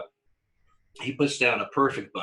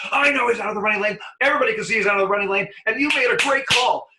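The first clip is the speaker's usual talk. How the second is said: high, loud and fast mock tirade; a loud, quick put-on rant; manager's mock rant; 11 semitones higher, 6 dB louder, faster than usual. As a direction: ham up an angry manager who is secretly agreeing. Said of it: "and he goes".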